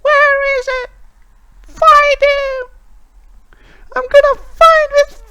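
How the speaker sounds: high, piercing distant scream; Lilith screaming. Acted. Witch screaming through the storm for the one she hunts, high screech. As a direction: scream it thin and high, drawing the words out. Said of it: "she's screaming something like".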